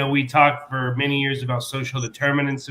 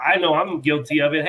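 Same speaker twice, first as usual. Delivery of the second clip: ordinary talk, nothing marked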